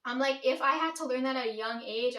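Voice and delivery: usual speaking voice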